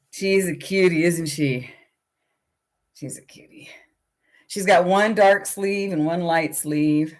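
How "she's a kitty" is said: whispered to the puppy; soft whisper over the sleepy puppy. Real, not acted. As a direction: whisper it softly. Talking to puppy.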